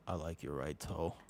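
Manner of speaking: put-on low, hushed flirty accent; put-on flirting accent. Flirting with somebody about a toe, low put-on accent. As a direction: drop into a soft, deep put-on accent. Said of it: "you have to do it in that accent".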